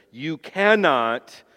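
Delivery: slow, with "cannot" weighted and falling; stress on cannot. Shown as a demonstration. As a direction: lean hard on "cannot", drawn out. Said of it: "and it means cannot".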